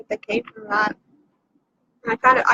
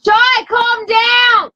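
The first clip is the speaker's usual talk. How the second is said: slow, loud call up the stairs; shouting upstairs; 5 dB louder, much slower than usual. Real, not acted. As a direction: call it out to the floor above. Yelling at a noisy child upstairs.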